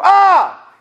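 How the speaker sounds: high, loud startled "Ah!"; sudden high-pitched scream of fright; scared yell back. Retold or imitated. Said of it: "so I yelled back".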